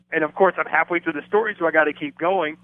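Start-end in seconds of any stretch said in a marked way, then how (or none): none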